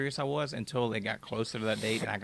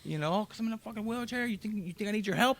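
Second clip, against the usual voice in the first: blowing up; 10 semitones higher than usual. Retold or imitated. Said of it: "I blew up on this lady".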